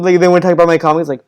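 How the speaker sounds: ordinary talk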